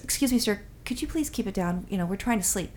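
imitating a hushed, polite passenger; polite soft request. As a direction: softly ask a stranger for quiet. Passenger politely asking for quiet.

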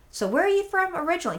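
high, lilting small-talk question; friendly charm. Retold or imitated. Customer charming the security guard, high friendly question.

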